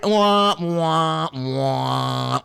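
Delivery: sarcastic boo-hoo crying; mock crying wah.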